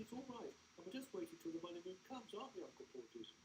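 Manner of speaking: put-on high, earnest little boy; a small boy sure of his prayer; child voice. A narrator's reading. Small boy assuring Anthea they will find a fortune, high and earnest.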